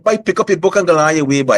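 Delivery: put-on high Guyanese accent, dismissive; a Guyanese parent waving it off. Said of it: "in the Guyanese accent".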